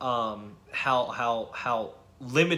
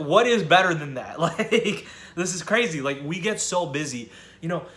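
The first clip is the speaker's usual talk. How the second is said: excited; 4 semitones higher, 6 dB louder than usual. Real, not acted. Raving about quarantine time.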